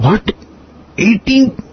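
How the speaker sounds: high, incredulous cry of eighteen; retold shock. Guru startled that eighteen devotees came, shocked high question. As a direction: echo his disbelief, pitch jumping up. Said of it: "And Swami was like".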